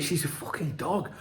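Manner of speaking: a mate's mock whisper in a nightclub; retold whisper. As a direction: lean in and drop the voice. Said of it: "whispered me, and he went".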